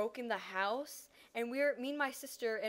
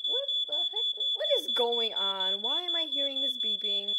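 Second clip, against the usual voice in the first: what is that noise, half-shouted; alarmed; 2 semitones higher, 7 dB louder than usual. Retold or imitated. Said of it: "And I'm like".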